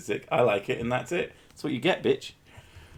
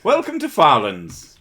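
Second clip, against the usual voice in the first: mock announcer; 5 semitones higher, 7 dB louder than usual. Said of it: "mock announcer welcome".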